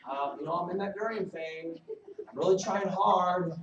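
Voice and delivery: a needy caller who loses it and ends up shouting; the worst voicemail, from cheery to fed-up shouting; frustrated voicemail building to a shout. Acted. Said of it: "here's the worst voicemail".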